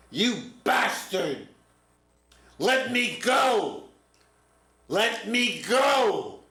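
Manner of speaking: put-on high, slow, shouted curse of a struggling kid; furious yell. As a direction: scream it at the counselor to be let go. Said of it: "and yells".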